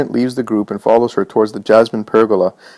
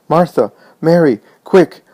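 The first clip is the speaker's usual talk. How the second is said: higher, louder alarmed calls rising to a shout; urgent calling; 7 semitones higher, 5 dB louder, slower than usual. Acted. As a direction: call the sisters urgently from across the house. Servant calls sisters in alarm.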